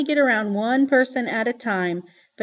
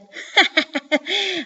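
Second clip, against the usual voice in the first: high cackling witch laugh; high wicked witch cackle; 8 semitones higher than usual. A narrator's reading. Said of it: "laughed just like the Wicked Witch of the West".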